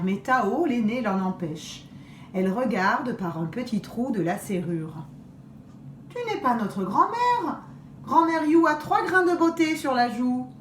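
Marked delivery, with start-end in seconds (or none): 6.1-10.5 put-on girl's voice. Eldest girl refuses wolf.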